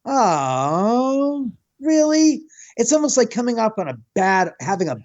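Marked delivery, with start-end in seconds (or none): 0.0-2.4 "really" jumps high in mock letdown; sarcastic letdown at meeting her; deflated surprise. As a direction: feign a disappointed surprise. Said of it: "overwrought with this sense".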